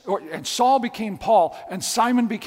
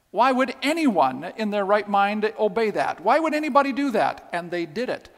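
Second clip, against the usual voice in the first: normal talking voice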